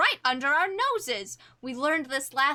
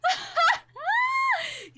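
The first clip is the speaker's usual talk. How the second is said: shrill mocking cackle, much higher; high cackling laugh. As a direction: cackle at the insult. Character mocks rival.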